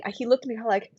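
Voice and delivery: usual speaking voice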